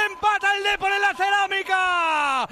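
high loud triumphant call; shouted goal call. Real, not acted. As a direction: roar out the equaliser. First call of the equaliser.

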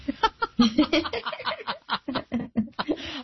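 long breathy fit of laughter. Real, not acted. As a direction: laugh remembering the prank. Laughs recalling prank.

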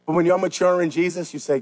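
normal talking voice

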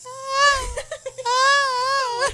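high, long mock scream; imitated distant scream. Imitating screams in the background of the paragliding video. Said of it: "in the background. It's like".

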